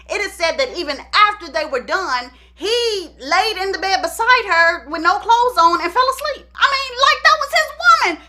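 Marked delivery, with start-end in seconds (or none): 2.6-8.2 incredulous. Real, not acted. Teller's disbelief at father's conduct.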